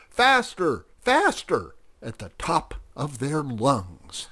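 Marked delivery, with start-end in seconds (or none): 0.1-1.7 high, loud squeal of glee; excited yelling. A narrator's reading. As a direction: yell it high like a rowdy little mouse. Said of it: "singing and shouting and yelling".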